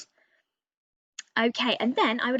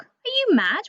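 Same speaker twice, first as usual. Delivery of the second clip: indignant outburst; 10 semitones higher, 6 dB louder than usual. Acted. Raven scolding the reader.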